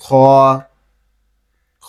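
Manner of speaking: drawn-out Arabic খ with a thick sound. Shown as a demonstration. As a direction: make খ heavy and full. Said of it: "এবং মোটা আওয়াজ হবে".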